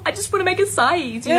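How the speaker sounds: high, pleading little voice; whiny high plea. Acted. Fired teen pleading to be left alone, high and whiny. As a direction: squeak it out like a hurt kid. Comic plea after firing story.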